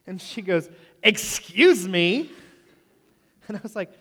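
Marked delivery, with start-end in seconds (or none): 1.0-2.3 a loud, very slow, haughty "excuse me"; indignant excuse me. Retold or imitated. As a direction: stretch every syllable out loud, offended. Rude lady snaps back at speaker.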